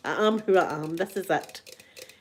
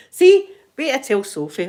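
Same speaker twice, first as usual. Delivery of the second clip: excited; 5 semitones higher, 8 dB louder than usual. Retold or imitated. Excited to tell her daughter.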